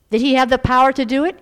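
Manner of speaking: loud, fast rhetorical question. Acted. Preacher challenging the congregation, loud and fast. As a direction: fire the question at them.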